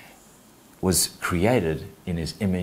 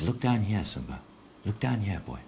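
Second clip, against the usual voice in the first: monkey's coaxing; 8 dB quieter than usual. Acted. Monkey leads Simba to the water, softly coaxing. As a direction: coax gently, dropping away on the name. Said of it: "And the monkey says".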